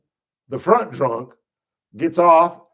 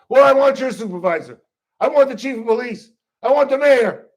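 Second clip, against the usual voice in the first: escalating demand; 5 semitones higher, 4 dB louder, faster than usual. Retold or imitated.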